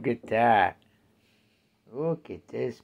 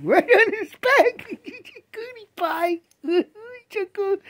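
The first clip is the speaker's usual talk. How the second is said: much higher, louder words through real laughter; laughing; 9 dB louder than usual. Real, not acted. Owner laughing at her cat lying on his back. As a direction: giggle it out at the cat.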